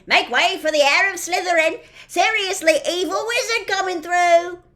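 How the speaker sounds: loud high mocking yell down the corridor; jeering shout. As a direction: bellow it out like a herald, teasing.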